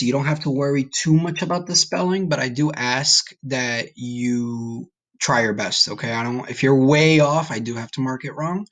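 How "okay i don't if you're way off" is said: normal talking voice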